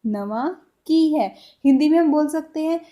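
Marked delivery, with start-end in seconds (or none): 0.1-1.3 "नवा की है" drawn out slowly; slow demo. Shown as a demonstration. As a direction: stretch it for the class. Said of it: "Punjabi में कैसे बोलेंगे". (बहुत धीरे-धीरे)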